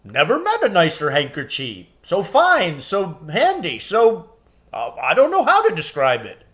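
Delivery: lightly voiced fond giant; admiring giant. A narrator's reading. Giant praising Lucy's handkerchief, fond. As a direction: gush over the handkerchief. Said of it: "said the giant politely".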